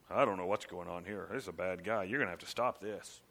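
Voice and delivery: low, soft helpless God; a God who shrugs and hands it over; resigned God. Pretend. Preacher voicing God giving up on his church, low and soft.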